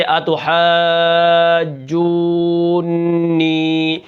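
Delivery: every doubled letter held long and slow; drawn-out tashdid. Shown as a demonstration. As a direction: spell out each shadda slowly. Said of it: "تین تشدیدیں اکٹھی آ جاتی ہیں". (تشدید کو کھینچ کر آہستہ)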